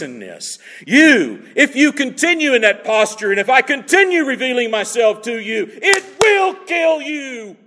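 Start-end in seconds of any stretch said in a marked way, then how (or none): 5.7-7.6 high, ringing shout of warning; preacher shouting the danger to his flock; sudden shout. Real, not acted. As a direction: cry it out as a stark warning. Preacher warns of God's holiness.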